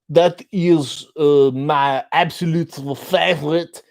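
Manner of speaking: overdone French accent, every word laboured; badly faked French, slow and deliberate; ridiculous fake French accent. Shown as a demonstration. Showing a person trying too hard, ridiculous French accent. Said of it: "being a person who's trying to do an accent".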